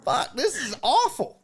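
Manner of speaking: high-pitched retold dismay; appalled. Comedian watching his censored video, appalled.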